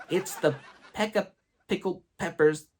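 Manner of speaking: high-pitched schoolkid correction; class correcting teacher. Retold or imitated. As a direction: pipe up like kids setting the teacher straight. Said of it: "The whole class be like".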